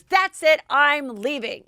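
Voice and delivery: high, loud furious snap; angry outburst. Retold or imitated. Leah losing it at Ramona, furious loud outburst. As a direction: storm off in a rage. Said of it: "gets really pissed at Ramona".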